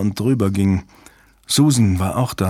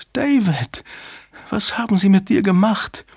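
high, grieving woman's voice; anguished calling; 10 semitones higher than usual. A narrator's reading. Susan calls to wounded David, anguished. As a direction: plead to him in high-pitched distress. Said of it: "die nach ihm rief".

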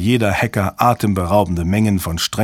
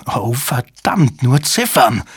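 shrieking; 2 semitones higher, slower than usual. A narrator's reading. Jabba curses the error message on screen, mild shriek. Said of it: "kreischte Jabba".

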